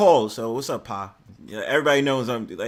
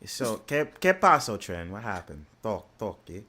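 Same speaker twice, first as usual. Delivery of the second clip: put-on softer, playful Spanish-accented greeting; joking Spanish voice; 7 semitones lower, 6 dB quieter than usual. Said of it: "I got in my Spanish voice".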